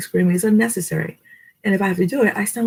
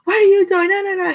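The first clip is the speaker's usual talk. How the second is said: mock scolding yell; 14 semitones higher than usual. Mom trying to punish her younger son, mock high yell. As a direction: act out a squeaky attempt at scolding. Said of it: "punish him and yell at him".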